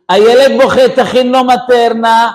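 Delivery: loud, high mock command; imitated yelling wife; wife ordering. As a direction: shout orders as the frantic wife. Wife orders husband to make formula.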